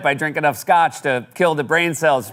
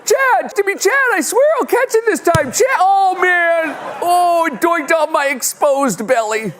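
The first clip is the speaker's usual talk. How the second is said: put-on whiny left-out kid; 13 semitones higher, slower than usual. Left-out kid begging the quarterback Chad for the ball, shrill and pleading. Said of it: "like the kid playing backyard football who never gets the ball".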